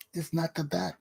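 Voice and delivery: soft echo of the line; dropped to near silence. Shown as a demonstration. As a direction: let the repeat sink quiet, almost to yourself.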